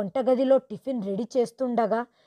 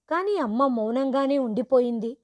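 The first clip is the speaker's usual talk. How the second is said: plain reading voice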